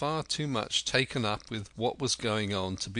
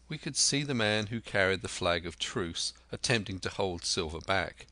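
ordinary delivery

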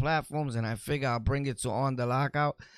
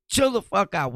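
loud; 6 semitones higher, 7 dB louder than usual. Real, not acted. Scolding an offended viewer.

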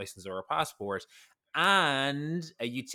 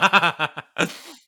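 loud laugh; 7 semitones higher, 12 dB louder than usual. Real, not acted. Laughing over watermelon.

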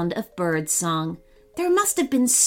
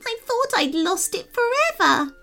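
high fairy voice, gushing with relief; a tiny fairy overwhelmed with thanks; breathless, grateful little fairy; relieved fairy; 11 semitones higher than usual. A narrator's reading. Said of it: "trembling with relief".

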